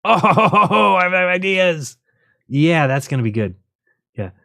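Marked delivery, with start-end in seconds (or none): 0.0-2.0 laughing with glee at your own idea; high, laughing burst. Real, not acted. Amused by his own idea.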